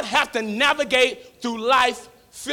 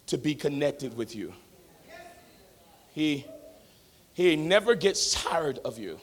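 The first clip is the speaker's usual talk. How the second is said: soft sermon voice; 5 dB quieter than usual. Acted. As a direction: bring it down to a hush for the congregation. Soft, intimate stretch of sermon.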